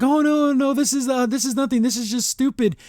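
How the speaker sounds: flustered panicked denial. Retold or imitated. Luce's panicked denial, voiced.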